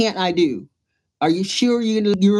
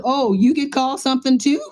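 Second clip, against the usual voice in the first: higher, amazed question; light up at the discovery; surprised realization; 6 semitones higher than usual. Retold or imitated. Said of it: "And I said".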